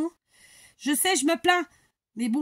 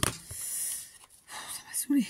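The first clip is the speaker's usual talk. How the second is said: breathy, drawn-out whisper of annoyance; fed-up whisper; 2 semitones higher, 8 dB quieter, much slower than usual. Real, not acted. Crafter grumbling to herself that it got on her nerves, whispered. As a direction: whisper it slowly, under your breath.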